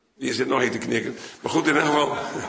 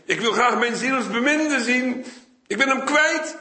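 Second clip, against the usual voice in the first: pleading bride; 12 semitones higher than usual. Acted. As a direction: cry out high like a lost lover.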